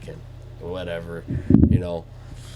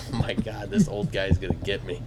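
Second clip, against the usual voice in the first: retold dread; 6 semitones lower, 9 dB quieter than usual. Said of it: "And it's like".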